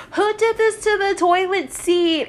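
high-pitched outraged imitation; mock indignant demand. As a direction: imitate someone demanding to know the culprit. Girl discovers mess, demands culprit.

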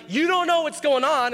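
high-pitched mock panic; freaking out. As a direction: imitate an agitated complaint to Jesus. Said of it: "we're freaking out like".

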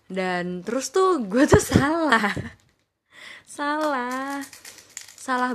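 laughing through the words; chuckling at own mistake. Real, not acted. Admitting mistake while laughing. (sambil tertawa)

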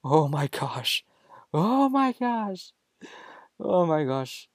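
high, loud, drawn-out panicked cry; hammed-up panic, peaking on the middle cry; mock inner scream. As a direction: scream it out like a silent voice in your head. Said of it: "the silent voice screaming out".

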